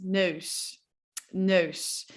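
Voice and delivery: neus with rounded closed eu; Dutch eu. Shown as a demonstration. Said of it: "if we're trying to say nose".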